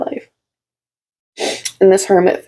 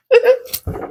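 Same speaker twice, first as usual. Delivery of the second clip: giddy laugh; 13 semitones higher than usual. Real, not acted. Joyful reaction during reading.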